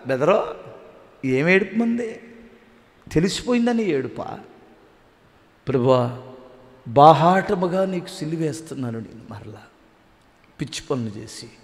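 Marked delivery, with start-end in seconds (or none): 5.7-11.5 tearful prayer. Retold or imitated. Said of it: "అని ఏడుస్తున్నావా".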